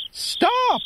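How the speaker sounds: a sudden squeaky high "Stop!"; high cry of the mouse. Acted. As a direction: cry out like a tiny startled mouse. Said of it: "cried the mouse".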